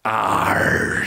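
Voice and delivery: drawn-out pirate growl; pirate captain. A narrator's reading. Captain answers Jack's refusal, pirate growl. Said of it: "said the captain".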